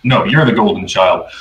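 ordinary talk, nothing marked

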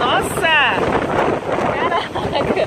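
high startled cry; startled exclamation. Real, not acted. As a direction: blurt it out, surprised. Reacting to strong gust. (espanto de verdade)

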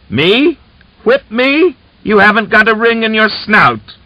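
very high, loud mocking shriek; shrill jeer. A narrator's reading. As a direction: screech it in his face. Widow jeering at Fomin.

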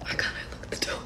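real frightened whisper, barely voiced; scared whisper. Real, not acted. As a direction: whisper so the people outside can't hear. Struggling to lock the door, scared.

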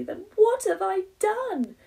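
high, loud panicked wail; despairing as he flees; frantic. Acted. Rufus fleeing the bun.

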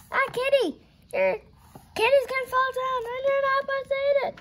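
high, rushed fretting squeal; mock alarm. Reader warns Katie about falling candy, high rushed alarm. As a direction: warn her in a playful panic. Mock scolding of story character.

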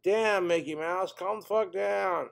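ordinary talk, nothing marked

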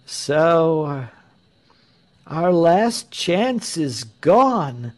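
high, slow, drawn-out lament; crowd's lament. A narrator's reading. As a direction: wail it mildly as a despairing crowd. Dismayed crowd laments lost leader.

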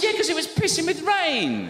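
high, loud, slow exasperation; exasperated. Retold or imitated. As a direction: spit it out in exasperation.

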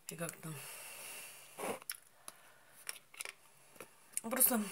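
chewing small berries; talking while eating fruit; mouth partly full. Real, not acted. Seen 1: Puts currants in mouth at -1 and 0.1, then chews while speaking.

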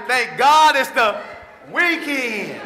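loud, slow, stretched celebration; imitating workers cheering the weekend; mock Friday cheer. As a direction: belt out the relief of Friday.

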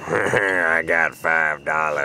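deep, slow, chuckling gloat; homeless drunk. Acted. Homeless man gloating over five dollars, slow drunken voice. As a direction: rumble it slowly, tickled with the cash.

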